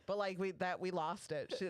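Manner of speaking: ordinary talk, nothing marked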